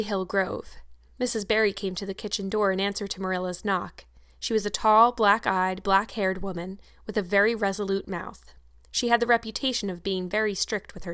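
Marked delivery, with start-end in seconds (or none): none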